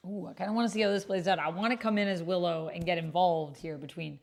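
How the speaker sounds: normal talking voice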